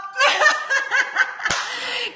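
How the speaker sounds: real high burst of laughter; gleeful laugh. Real, not acted. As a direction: laugh out high after blocking a troll. Laughs after blocking a troll.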